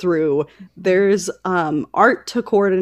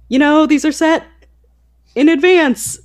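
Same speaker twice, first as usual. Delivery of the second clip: mock calm, patient explaining voice; sweetly reasonable, raised a little; a patient moderator answering an upset reader; 6 semitones higher, 3 dB louder than usual. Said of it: "Very reasonable responses".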